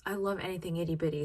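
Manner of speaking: ordinary talk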